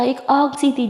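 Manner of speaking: plain reading voice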